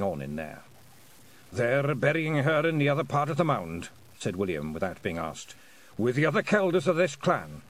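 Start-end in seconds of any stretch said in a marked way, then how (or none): 1.5-3.9 pitched-up character voice with rolled R's. A narrator's reading. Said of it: "sounding his R's like a drum roll". 6.0-7.6 pitched-up character voice with rolled R's. A narrator's reading. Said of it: "sounding his R's like a drum roll".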